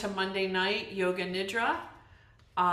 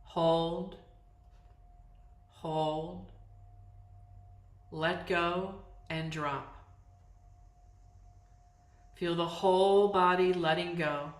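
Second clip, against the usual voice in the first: calm, unhurried meditation voice with long pauses; guided yoga nidra. Real, not acted. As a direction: guide them into letting go, phrase by phrase. Leads yoga nidra practice.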